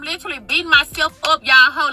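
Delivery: ordinary delivery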